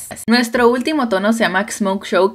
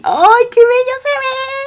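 much higher, slow, gushing; delighted squeal; 3 dB louder, slower than usual. Real, not acted. Vlogger admiring her glitter liner for viewers, delighted squeal. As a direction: coo over it like it's the prettiest thing.

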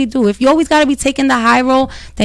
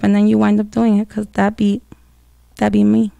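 ordinary talk, nothing marked

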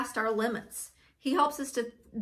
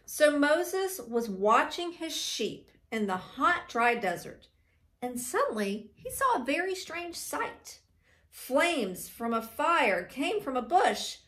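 measured, expressive storytelling; story reading to children; 4 semitones higher than usual. A narrator's reading. Teacher reading the burning bush story, expressive storytelling. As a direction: draw out the suspense for young listeners.

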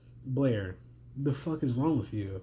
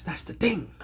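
whispered aside; 3 semitones higher than usual. Retold or imitated. Voicing an imagined reaction.

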